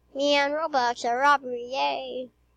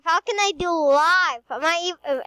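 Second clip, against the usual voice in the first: normal talking voice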